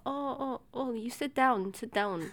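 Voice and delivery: mimicked masseuse. Customer mimicking masseuse seating her, lightly put-on voice. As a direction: imitate her gently ordering a customer about.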